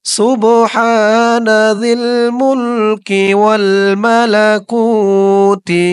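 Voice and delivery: slow chanted সুবহানা জিল মুলকি; slow recitation. Shown as a demonstration. Teacher models the phrase for viewers to repeat, chanted. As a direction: recite it long and even for repeating. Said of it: "আমার সাথে বলুন".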